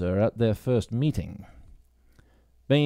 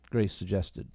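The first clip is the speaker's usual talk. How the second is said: ordinary delivery, nothing marked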